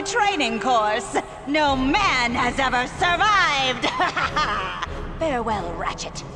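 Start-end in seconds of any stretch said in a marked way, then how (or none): 3.9-5.1 quiet evil laugh. Acted. Villain soft evil laugh.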